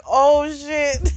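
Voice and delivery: the penny drops all at once; oh no moment. Retold or imitated. Viewer realizing the child is his, stunned. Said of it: "And I'm like".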